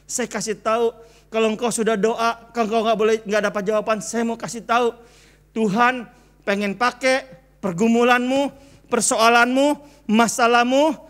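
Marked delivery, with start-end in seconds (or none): 5.5-11.0 louder, slowing on the last phrase; full sermon fervor; forceful preaching. Acted. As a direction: drive the promise home. Preaching climax.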